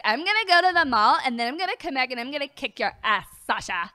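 loud, exaggerated wrestler trash talk; hammed-up mock threat, pushed loud and sing-song; Nia Jax impression. Co-host mocking Nia's promos, hammed-up mimicry. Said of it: "Why does Nia talk like that?".

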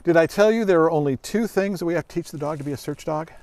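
normal talking voice

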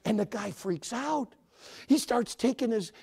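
ordinary talk

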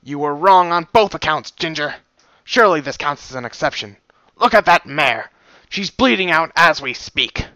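loud, firm scolding; stern rebuke. Acted. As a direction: dress her down in public. Stallion rebukes guard.

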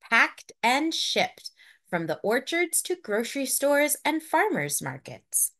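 ordinary delivery

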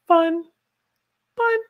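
sudden very high, loud fun; squeaky high fun. Pretend. Teacher reading a viewer's cheerful message. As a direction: pop the last word up high.

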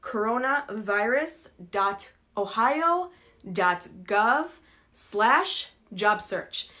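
spelling out a web address slowly; each word slow and separate; slow dictation. Shown as a demonstration. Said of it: "الويب سايت اسمه".

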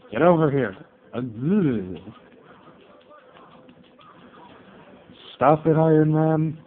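loud frustrated yell at the screen; shouting at the game. Real, not acted. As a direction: yell it at the CPU fighter. Yelling at Iron Man in game.

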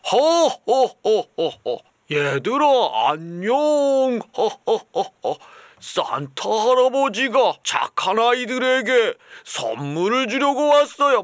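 loud, slow jolly Santa laugh and greeting; hearty mock Santa to the kids. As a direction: ham up a kindergarten Santa. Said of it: "산타클로스".

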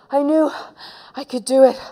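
puffed, trying to catch breath. Acted. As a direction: gasp it out as if just done running. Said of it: "trying to stop panting".

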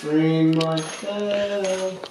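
stretched out very slowly; slow drawn-out. Shown as a demonstration. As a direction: drag every word out. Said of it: "What do you say?".

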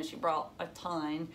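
plain reading voice

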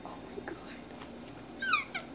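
very slow, barely voiced whisper; awed whisper. Real, not acted. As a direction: whisper it slowly, overwhelmed by excitement. Overwhelmed on opening the shirt.

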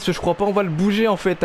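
ordinary delivery

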